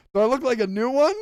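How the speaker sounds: loud, high imitated question; mock flirty comeback. Imitating an actress calling after a star, mock flirty comeback. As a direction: pitch it up and rise toward the end. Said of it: "like that".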